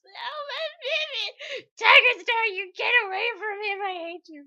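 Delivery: high, very loud crying shout; anguished shout. Retold or imitated. Fan shouting at Tiger Star to leave the kit, anguished cry. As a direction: wail like a mother defending her baby. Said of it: "Exactly that voice".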